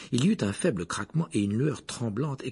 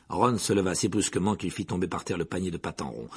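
ordinary delivery

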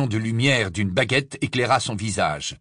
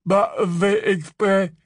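mumbled through blood; 9 semitones higher, slower than usual. Acted. Ron mumbles with swollen mouth.

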